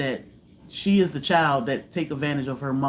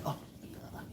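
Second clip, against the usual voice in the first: soft whispered exhale of shock; whispered relief; 21 dB quieter than usual. Retold or imitated. Recalling the near dog fight, whispered. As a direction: breathe it out in a whisper.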